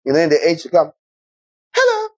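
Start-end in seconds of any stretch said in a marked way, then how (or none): 1.7-2.1 very high squeaky dog greeting. Pretend. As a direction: play a friendly dog saying hello, pitched way up. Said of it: "So when a dog walks up to you".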